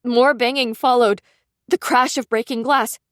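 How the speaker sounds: usual speaking voice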